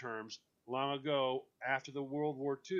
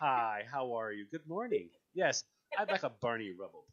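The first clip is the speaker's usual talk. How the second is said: polite mock order; 3 semitones lower than usual. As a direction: say it sweetly, like a customer ordering. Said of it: "hear you walk in and go".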